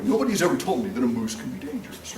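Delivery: ordinary talk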